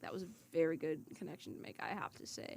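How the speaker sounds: trailing off into a yawn; soft, fading, yawning delivery; yawning sigh. Real, not acted. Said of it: "Sorry, I'm yawning now".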